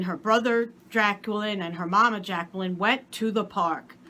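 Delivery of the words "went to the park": usual speaking voice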